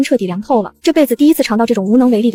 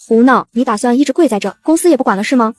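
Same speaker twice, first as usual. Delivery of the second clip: ordinary delivery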